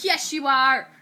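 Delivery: loud retold contradiction; mock insistence. Retold quarrel, boy insists.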